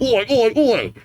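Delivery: high, rowdy Cockney oi oi oi. Acted. Reader warming up a grunt's Cockney voice, rowdy. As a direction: shout it like a Cockney lout. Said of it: "get into that Cockney mindset".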